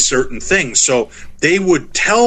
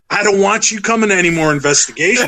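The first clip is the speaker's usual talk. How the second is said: higher-pitched, half-laughing complaint; mock irritation; 7 semitones higher than usual. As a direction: imitate an exasperated friend scolding you. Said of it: "like, irritated by it".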